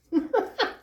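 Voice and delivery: a quick high delighted laugh; bright laugh. Real, not acted. Reader laughing with joy at her viewers' luck.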